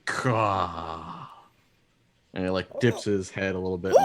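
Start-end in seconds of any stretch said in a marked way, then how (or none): 0.0-1.3 long drawn-out bird call; slow raven croak; raven caw. Acted. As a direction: let one caw trail out slowly. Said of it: "turns back to him and goes".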